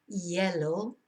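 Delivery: "yellow" starting on a y sound, no H; silent H. Shown as a demonstration. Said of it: "you don't pronounce the H".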